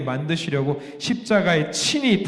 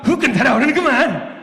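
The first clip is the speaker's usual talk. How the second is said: higher, playful Jeolla dialect catchphrase; 5 semitones higher, 4 dB louder than usual. Shown as a demonstration. Preacher repeats the Jeolla comedy catchphrase, playful. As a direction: toss off the Jeolla line like a comedian. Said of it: "이거를 뭐냐면 이렇게 말해요".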